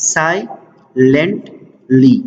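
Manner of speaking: سایلنټلی very slowly as sai, lent, lee with pauses; syllable by syllable. Shown as a demonstration. Teacher showing three syllables, سایلنټلی said syllable by syllable. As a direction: chop سایلنټلی into three separate beats. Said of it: "three vowel sounds".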